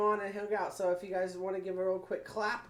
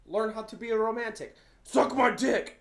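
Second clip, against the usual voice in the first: put-on shouted British accent, higher, the last words yelled; angry British accent; 4 semitones higher, 8 dB louder than usual. Recalling the angry British traffic guy: shouted British accent. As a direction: play the angry British road rager, building to a shout. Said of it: "I'm gonna try and do an accent".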